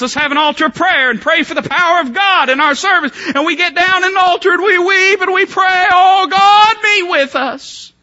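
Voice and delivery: high, loud, wailing hammed-up prayer; mock fervent prayer. Preacher mimics hypocrites praying at altar, high loud wail. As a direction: play the weeping hypocrite at the altar.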